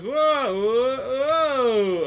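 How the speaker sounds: loud, high mock cries of a thrilled rider; imitated rider whoa. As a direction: ham up a passenger's whoas. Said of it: "this one literally goes".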